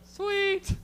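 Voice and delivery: high, loud burst of delight; gleeful cheer. Acted. Comic celebrates having a new girlfriend, high gleeful cheer. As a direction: squeal it out in triumph.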